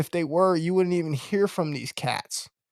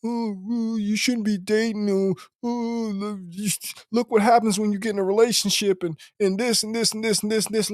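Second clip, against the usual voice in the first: higher, sing-song mocking voice; mock the MGTOW guys in a high whiny voice; 5 semitones higher than usual. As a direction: parody the complainers as whiny and silly. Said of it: "You have these guys that sit up here and say".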